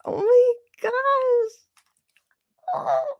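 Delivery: high, slow, teary oh my gosh; choked up with happy tears; near tears. Real, not acted. Streamer moved by a donation, teary and slow. Overwhelmed with emotion.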